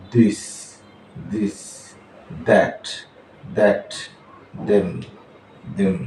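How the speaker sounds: দিস with a soft voiced th, near a Bengali দ; soft th sound. Shown as a demonstration. Teacher drilling দিস and দ্যাট for pupils, soft th. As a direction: lay the tongue soft for দ্যাট. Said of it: "দয়ের মতো উচ্চারণ আসবে".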